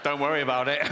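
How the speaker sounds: smug goldfish, quite unbothered; acted smug reassurance. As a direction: brush it off smugly. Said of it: "And he's all smug then".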